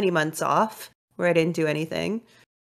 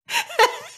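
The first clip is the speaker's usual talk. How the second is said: sudden giggling laugh much higher; high burst of laughter. Real, not acted. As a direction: let a real laugh break out. Laughing at cat puke story.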